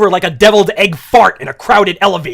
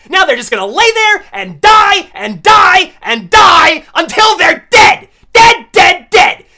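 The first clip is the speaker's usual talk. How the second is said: louder, higher, hammering the word dead; escalating shout; 9 semitones higher, 8 dB louder than usual. Real, not acted. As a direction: build a manic morbid yell. Escalating mock-morbid rant.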